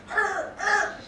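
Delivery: high whining dog howl; high-pitched mock dog protest; imitated dog noise. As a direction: imitate a dog whimpering with its mouth shut. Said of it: "He's going".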